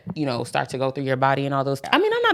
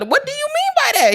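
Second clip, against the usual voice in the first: much higher, louder mock question; 5 dB louder than usual. Her pressing boyfriend to clarify, high and insistent.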